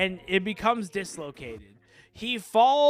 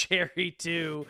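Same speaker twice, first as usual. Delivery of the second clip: ordinary talk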